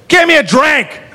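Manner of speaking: high-pitched mock drunken yell; drunken demand. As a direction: call out to the whole bar, hammered. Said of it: "to the whole bar".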